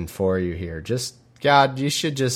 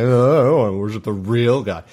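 mocking Israelites; 3 dB louder than usual. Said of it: "And they're like".

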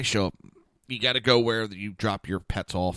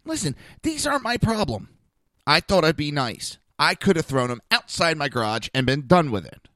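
retold irate complaint, high at first then louder; pissed off; 4 semitones higher, 5 dB louder than usual. Man retelling his complaint at the front desk, pissed off. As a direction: lay it out with raised-voice indignation. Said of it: "So I get pissed off".

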